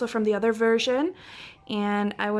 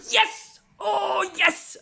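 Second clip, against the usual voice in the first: much higher joyful cries; excited cheer; 9 semitones higher, much slower than usual. Real, not acted. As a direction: cheer like you won. Cheers on the wanted group card.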